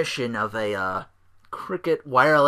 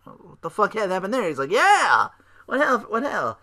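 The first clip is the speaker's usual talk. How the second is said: mock Ziggler; 7 semitones higher than usual. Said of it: "Ziggler's like".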